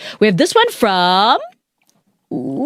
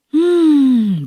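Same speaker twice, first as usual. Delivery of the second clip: relaxed mock hum, higher; contented hum; 6 semitones higher, 3 dB louder than usual. Capybaras soaking, a contented hum.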